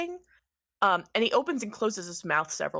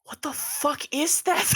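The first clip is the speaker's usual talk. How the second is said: high-pitched appalled outburst; horrified son; 7 semitones higher than usual. Acted. Son reacts to the frog, high and horrified. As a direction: blurt it out, high and aghast. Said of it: "And Clifford goes".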